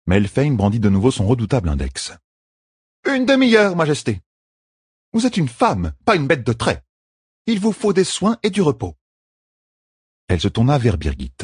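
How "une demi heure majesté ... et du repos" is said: higher, steady caregiver's voice; firm midwife. A narrator's reading. Midwife explains the treatment to the queen, firmly. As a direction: lay out the rules kindly but firmly.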